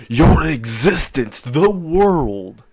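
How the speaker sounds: slow, rising battle cry; dramatic attack call. Acted. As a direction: announce your finishing move, drawn out. Character calls out his attack.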